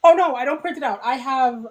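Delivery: ordinary talk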